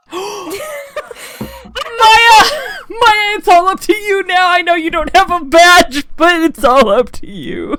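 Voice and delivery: loud, high desperate plea; desperate call. Acted. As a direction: call out to her, all hope on her. Phoenix calling out to Maya.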